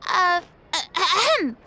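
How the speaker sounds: a staged ahem; theatrical ahem; throat clearing. Acted. Clearing throat before greeting.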